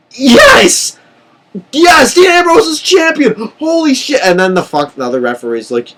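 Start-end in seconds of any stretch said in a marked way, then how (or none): 0.1-4.2 high, loud cheer for the new champion; overjoyed cheering. Retold or imitated. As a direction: celebrate like a fan jumping off the couch. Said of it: "And I'm like".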